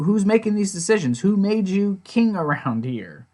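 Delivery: high, indignant questioning; defiant challenge. Retold or imitated. Jim challenging Shane's authority, indignant. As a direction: challenge his authority, stretching out the last question.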